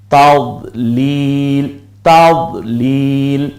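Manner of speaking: তাদলিল with the long li stretched; slow tajweed drawl on তাদলিল; drawn out. Shown as a demonstration. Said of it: "টেনে বলবো".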